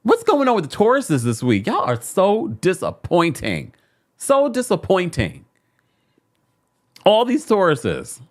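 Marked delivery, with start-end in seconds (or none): 1.7-8.2 slow and high, sighing the words out; exasperated, drawn-out disappointment. Real, not acted. Vlogger scolding the Tauruses, slow high exasperation. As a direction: let them hear your letdown. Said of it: "disappointed in Tauruses".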